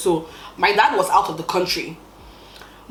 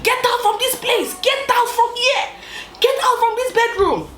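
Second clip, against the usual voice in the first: very high-pitched furious order, imitated; screaming mom. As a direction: play mom shrieking to chase a child away. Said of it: "My mom was screaming at me".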